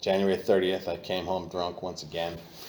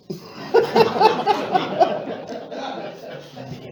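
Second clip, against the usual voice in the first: long breathless laugh; high rueful laugh; 6 dB louder than usual. Real, not acted. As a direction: laugh at your own absurdity. Laugh after realizing he needs help.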